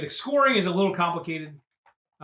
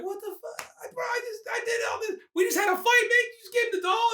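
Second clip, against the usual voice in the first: squeaky, much higher indignant complaint; high whiny outrage. Retold or imitated. Arnold complaining to the kid about the doll, high and whiny. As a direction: whine like a cheated man.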